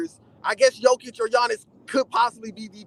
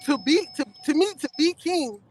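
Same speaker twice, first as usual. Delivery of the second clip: ordinary delivery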